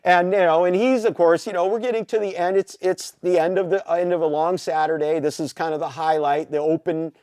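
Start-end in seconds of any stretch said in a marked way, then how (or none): none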